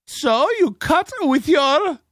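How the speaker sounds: slow, high-pitched mimicry of her affect; exaggerated high, drawn-out imitation; put-on affected voice. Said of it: "she's going into that affect".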